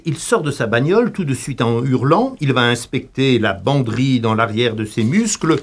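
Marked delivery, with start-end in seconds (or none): none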